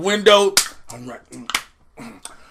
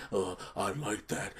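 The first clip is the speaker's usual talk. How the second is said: much lower, softer swamp monster; low mumbling Swamp Thing; 13 semitones lower, 19 dB quieter than usual. Retold or imitated. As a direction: grumble it down low. Swamp Thing voiced in recap.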